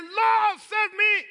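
very high, loud plea; Peter's desperate cry. Acted. Peter calls Jesus the moment he sinks, in a high urgent shout. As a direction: shout it like a drowning man. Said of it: "but immediately he said".

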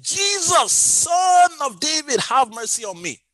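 high, loud, drawn-out cry for mercy; desperate shout. Acted. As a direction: shout it out like the blind man begging, easing off at the end. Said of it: "he shouted".

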